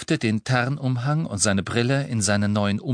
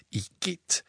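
soft high disgusted breath; 8 semitones higher, 7 dB quieter than usual. A narrator's reading. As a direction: recoil in disgust. Disgust at vomit.

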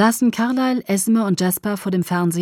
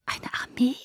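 higher, whispered echo in disbelief; whispered question; 5 semitones higher, 3 dB quieter than usual. A narrator's reading. Alice echoing Jasper's revelation, whispering. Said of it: "flüsterte Alice".

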